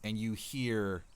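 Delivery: ordinary talk, nothing marked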